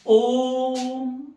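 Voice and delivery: ओम chanted, long and loud; prolonged om. Shown as a demonstration. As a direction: stretch ओम like a chant. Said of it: "इसको बोलने में ... ज्यादा समय लग रहा". (ओम को प्लुत, बहुत लंबा खींचकर)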